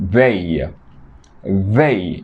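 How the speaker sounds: "They" with the tongue between the teeth, voiced th; th sound. Shown as a demonstration. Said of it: "Estoy poniendo mi lengua entre mis dientes".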